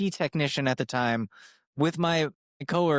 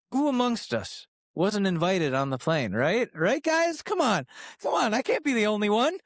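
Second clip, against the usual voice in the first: higher, coaxing mock appeal; mock pleading; 8 semitones higher than usual. Host coaxing listeners to confess, in a high mock plea. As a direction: beg them to admit it, tongue in cheek.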